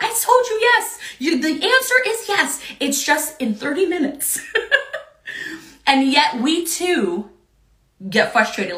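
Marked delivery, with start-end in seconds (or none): 0.0-4.4 mock exasperated mother, pitch up; exasperated parent. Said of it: "you're like". 4.5-5.0 breathy laugh. Real, not acted. Laugh after quote.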